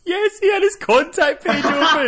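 high, loud, delighted outburst; laughing exclamation. Real, not acted. Host cracking up at the phone-screen detail, laughing exclamation. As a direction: shout it through real laughter.

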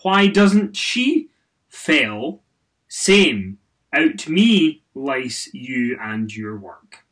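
slow, loud, deadpan word by word; reading a typo-filled tweet. Pretend. Host reading a fan's garbled tweet aloud, slow and loud. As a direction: read each word stiffly as misspelled. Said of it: "let's try one more time".

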